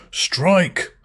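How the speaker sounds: high, loud shouted command; one sharp, high-pitched yell; yelled order. A narrator's reading. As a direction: yell it out as a sudden order to the executioner. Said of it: "someone yelled".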